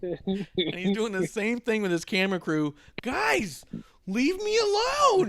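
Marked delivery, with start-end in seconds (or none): 3.0-5.3 very high, drawn-out mock whine; exasperated plea. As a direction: imitate him begging the camera crew off, loud and high. Acts wrestler pleading with camera crew.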